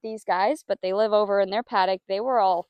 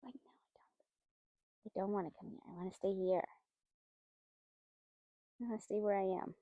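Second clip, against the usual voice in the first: voicing the horse's refusal; 14 dB quieter than usual.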